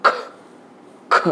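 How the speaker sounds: ख as a short throat burst, like coughing; two isolated ख sounds, throat closing and releasing. Shown as a demonstration. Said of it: "जैसे आप खांसते वक्त". (खांसने जैसा कंठ से ख)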